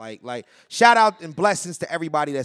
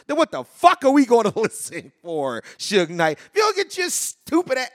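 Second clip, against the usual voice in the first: loud, rising exasperated rant; really letting him have it; heated outburst; 7 semitones higher, 5 dB louder than usual. Real, not acted. Scolding Suge Knight, then regrets.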